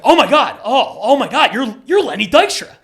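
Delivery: loud, high starstruck outburst; freaking out. Retold or imitated. Driver recognizing his famous passenger, freaking out loudly. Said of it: "I start freaking out".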